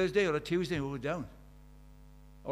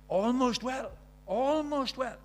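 higher, thin-voiced old man on his deathbed, serene; dying Baxter; 8 semitones higher, 4 dB louder, slower than usual. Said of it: "Richard Baxter on his deathbed".

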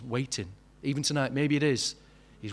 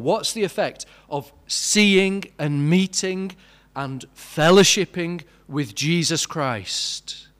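louder, slow, swelling preacher's climax; preaching at full force; 3 semitones higher, 7 dB louder, slower than usual. Acted. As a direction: drive each question home from the pulpit. Sermon climax builds.

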